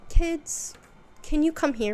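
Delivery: high, loud call across the room. Retold or imitated. Dante calling the kids over, high and loud. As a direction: summon the kids from afar.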